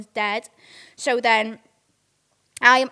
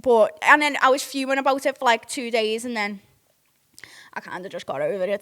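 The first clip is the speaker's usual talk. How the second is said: usual speaking voice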